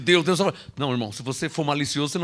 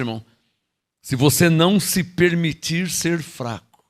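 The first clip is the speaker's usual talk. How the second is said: normal talking voice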